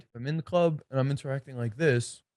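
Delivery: low, dull monotone with no energy; flat and withdrawn. Shown as a demonstration. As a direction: mumble it like you can't hold eye contact.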